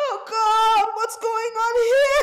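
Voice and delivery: mock sobbing wail, high and loud; overplayed tearful lament; hammed-up crying. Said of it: "I'm trying not to cry".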